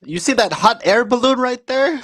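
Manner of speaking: high-pitched imitation of the uncle; proud uncle pointing out the town, voiced high.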